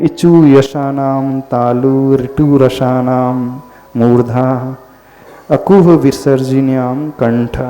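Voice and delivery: slow chanted grammar rule; sutra recitation. Acted. (धीमे स्वर में सूत्र पाठ)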